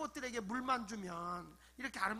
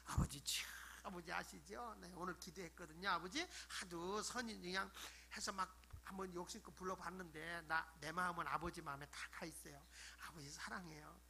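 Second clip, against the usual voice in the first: soft, lower, confiding prayer; affectionate aegyo; 5 semitones lower, 10 dB quieter than usual. Acted. Chatting with Father God after praying, tender and coaxing. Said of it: "막 이러면서 애교 떨어봐".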